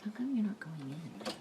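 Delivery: breathy whisper to herself. Real, not acted. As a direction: whisper it at the stubborn part. Talking to a stuck screw.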